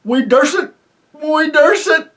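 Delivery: high, loud, eager agreeing roar; the other voices roar their eager agreement; roaring chorus. Acted. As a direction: shout it like a crowd of cheering yokels. All the voices refuse.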